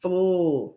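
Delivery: "fl" as a held blend, f sliding into l; blended sound. Shown as a demonstration. Teacher showing the first sound, blend drawn out. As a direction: hold the blend "fl" without finishing the word. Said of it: "the first sound we hear".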